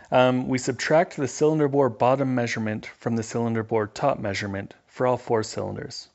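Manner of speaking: ordinary talk, nothing marked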